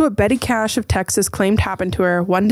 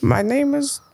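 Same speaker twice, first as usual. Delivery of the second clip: higher, odd put-on alien greeting; mock alien visitor; 5 semitones higher, slower than usual. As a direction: introduce yourself as a comic alien. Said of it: "And they go".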